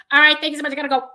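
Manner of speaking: loud, high, acted hasty goodbye; urgent sign-off. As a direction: blurt thanks as you flee the stage. Acts fleeing the stage in urgency.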